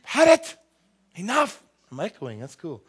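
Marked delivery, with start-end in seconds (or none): 0.0-1.6 loud, high exasperated shout; fed-up grandma yell. Retold or imitated. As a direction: blow up like a fed-up mom. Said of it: "your mom or your grandma say".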